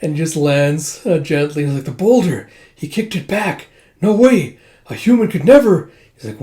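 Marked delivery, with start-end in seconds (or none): 1.9-5.9 high, loud cries of amazement; acted shock, pitched well up; astonished disbelief. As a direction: exclaim like stunned soldiers. Retold soldiers astonished at kicked boulder.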